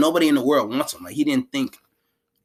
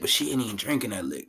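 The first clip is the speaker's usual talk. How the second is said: ordinary talk, nothing marked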